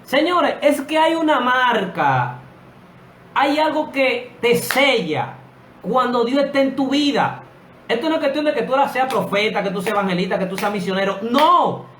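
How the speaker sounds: high, loud preacher's cry; fiery preaching. Acted. Preacher stirring up his congregation, loud fiery preaching. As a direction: preach it to the rafters, full force.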